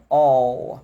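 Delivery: all with a long dark L; dark L demo. Shown as a demonstration. Teacher asking the class to watch his mouth, dark L. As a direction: draw out all, tongue back. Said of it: "Vai prestando atenção".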